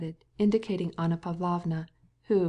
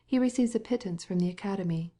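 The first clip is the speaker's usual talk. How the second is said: ordinary delivery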